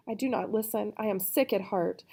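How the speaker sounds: plain reading voice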